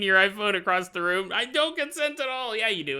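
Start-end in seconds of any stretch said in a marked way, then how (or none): 1.3-2.6 high-pitched mock refusal; hammed-up outraged listener; imitated listener protest. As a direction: object indignantly in a raised voice. Acted listener protesting, repeated.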